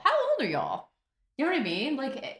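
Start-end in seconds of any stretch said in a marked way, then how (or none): 0.0-0.9 put-on higher shocked repeat of How old are y'all; higher mock disbelief. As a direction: repeat it even more astonished. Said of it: "you're like".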